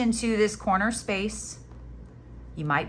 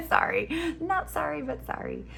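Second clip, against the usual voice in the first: high-pitched, giggly sorry; laughing apology; 10 semitones higher than usual. Real, not acted. Apologizing to viewers for the film quote, laughing.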